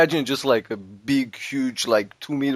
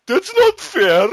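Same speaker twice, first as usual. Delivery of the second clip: high, loud whining protest; mock crying; 10 semitones higher, 6 dB louder than usual. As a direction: wail like a wronged drunk, hammed up. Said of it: "he starts crying about it".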